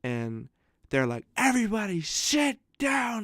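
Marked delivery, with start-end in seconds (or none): 1.4-3.2 high, drawn-out call to a room; stretched, high-pitched mock order; mock teacher yell. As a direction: imitate a teacher calling everyone to order, slowly. Teacher trying to seat a cafeteria.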